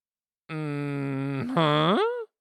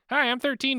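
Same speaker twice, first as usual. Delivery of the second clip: put-on thirteen-year-old [name]; 10 semitones higher than usual. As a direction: play a bouncy young boy, pitched way up. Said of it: "I'm thirteen-year-old [name] for the rest of this episode".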